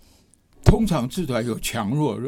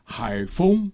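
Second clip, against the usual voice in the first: 海风 said a little higher, as an example; stress on 海风; 4 semitones higher than usual. Shown as a demonstration. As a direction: say 海风 as a spoken demo. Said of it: "我们讲说".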